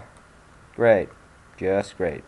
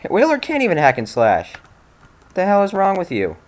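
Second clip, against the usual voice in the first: higher and louder, fed up with the game; exasperated yelling; 9 semitones higher, 4 dB louder than usual. Real, not acted. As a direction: yell at the screen in disbelief.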